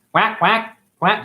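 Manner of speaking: duck quack. Pretend.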